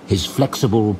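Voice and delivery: ordinary delivery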